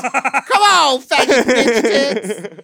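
high, loud, slowly stretched yell; playful shout. Real, not acted. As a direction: drag out a loud teasing holler. Joining the shouted banter.

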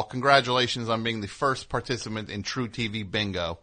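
ordinary talk, nothing marked

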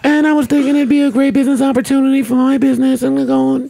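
squeaky put-on woman voice; man doing a woman's voice. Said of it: "You dating a Muppet baby".

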